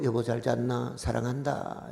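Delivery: quiet, gentle morning words; soft tender greeting. Retold or imitated. A husband waking his wife, softly and tenderly. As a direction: murmur it softly to a sleeping wife.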